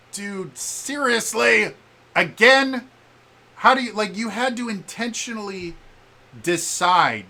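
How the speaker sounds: high, loud outburst of disbelief; exasperated. Acted. Gamer to the developers over bad direction, exasperated. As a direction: protest loudly, stretching the words in exasperation. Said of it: "bad enough I'm like".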